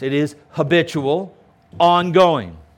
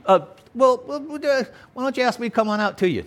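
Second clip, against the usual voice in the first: mock hesitant asking; 8 semitones higher, faster than usual. Mock timid Peter alternative.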